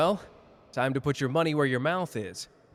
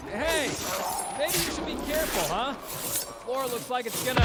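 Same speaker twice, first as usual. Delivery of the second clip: high, fast, urgent call; alarmed warning; 9 semitones higher, faster than usual. Acted. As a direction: warn them before the floor collapses. Warning as the floor gives way.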